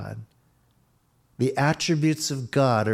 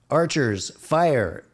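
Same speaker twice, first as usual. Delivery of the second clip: high, loud hammed-up army command; mock commanding officer; 4 semitones higher, 4 dB louder than usual. Old Naaman ordering archers to fire, mock commanding. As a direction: bark orders like a pompous officer. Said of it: "British or American accent like that".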